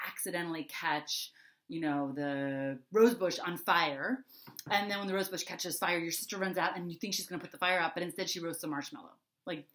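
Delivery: usual speaking voice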